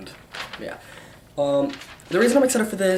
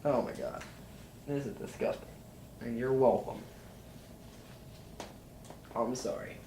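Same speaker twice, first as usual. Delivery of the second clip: quiet; 3 semitones lower, 13 dB quieter than usual. Real, not acted. Right after blowing his nose.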